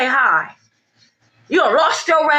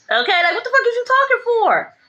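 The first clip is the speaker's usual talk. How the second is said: high-pitched, exasperated demand; indignant outburst; 10 semitones higher than usual. Real, not acted. Reader tells off a gossip speaking for her, indignant and high. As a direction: raise the pitch sharply in exasperation.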